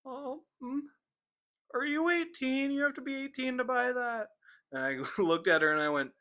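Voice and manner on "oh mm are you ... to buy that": squeaky cashier. Retold or imitated. Young cashier asking the teen for age, high polite voice. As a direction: lift it up high and sweet like a nervous young cashier. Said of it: "she goes".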